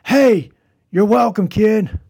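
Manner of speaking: mock a shouted you're welcome; sarcastic yell. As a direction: player yells sarcastically after the ungrateful kid, loud. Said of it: "I heard him yell at me".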